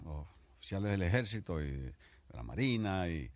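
ordinary talk

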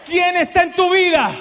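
rousing shout. Real, not acted. Preacher opens with a cry.

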